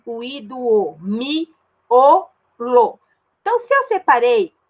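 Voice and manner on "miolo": "Miolo" broken into mi... o... lo; deliberate syllable demo of "Miolo". Shown as a demonstration. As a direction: pause after every syllable of "Miolo".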